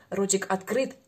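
usual speaking voice

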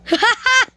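short, high burst of laughter; quick high-pitched cackle. Real, not acted. Gamer laughs at another player, high laugh.

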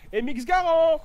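calling out the gun name, thrilled; excited. Real, not acted. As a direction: Garand called out with sudden excitement. Repeats it louder, higher.

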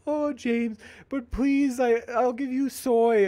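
very high, slow, begging put-on voice; mock pleading. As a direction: ham up a whiny plea offering soy. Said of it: "And she's like".

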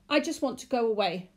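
tense, forceful insistence; hissed. A narrator's reading. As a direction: hiss it urgently at the clerk. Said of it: "the man hissed".

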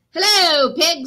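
storybook duck hailing the dog; cheery duck greeting. Acted. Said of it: "said Duck".

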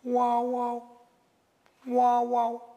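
high, drawn-out "wow, wow" bird cry; level, high-pitched raven cry; raven call. Acted. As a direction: caw from a high branch. Raven calling from the tree.

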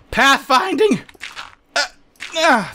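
high-pitched exasperated outburst; exasperated cry. Real, not acted. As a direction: cry it out in frustration. Grumbling at the game's pathfinding.

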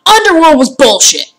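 gasp, then a loud high outburst; mock-calm outburst. Real, not acted. Gamer ranting about Underworld, loud and high. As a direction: promise calm, then explode. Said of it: "in a relatively calm manner".

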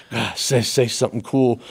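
laughing through nervous thoughts; nervous giggly self-talk; laughing nervously. Retold or imitated. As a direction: chuckle it out, panicky.

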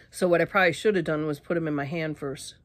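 ordinary talk, nothing marked

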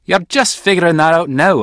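loud, scornful comeback; villain's taunt. Acted. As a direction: throw it back at the hero, loud and mocking. Villain answers the challenge.

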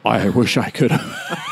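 slow, lightly voiced president; hint of a president, slowed; president impression. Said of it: "impersonated a president".

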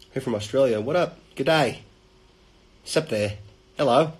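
lightly attempted, poor Australian accent; clumsy stab at an Aussie accent; bad Australian accent. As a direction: greet them in a badly faked Australian accent. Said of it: "one of the worst Australian accent".

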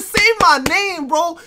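high, slow hype quote; hyped quote. Retold or imitated. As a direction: relive your own call. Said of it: "I said".

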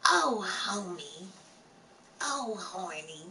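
slow Marge impression, a bit raspy; Marge Simpson voice. Streamer joking with chat in a slow, raspy Marge voice. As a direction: drawl it like Marge Simpson, then the naughty twist. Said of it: "that's my Marge Simpson voice".

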